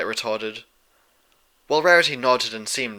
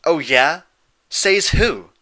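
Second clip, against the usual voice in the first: louder, higher defiant jab; cocky challenge; 6 semitones higher, 9 dB louder, much slower than usual. A narrator's reading. Dash challenging the princesses, louder and cocky. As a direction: puff up and dare them. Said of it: "Dash asked, puffing out her chest".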